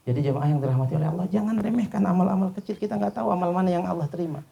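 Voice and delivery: fast, high and loud exhortation; preaching with force. Real, not acted. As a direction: hammer the lesson home. Preacher driving home the lesson. (berkhotbah dengan semangat)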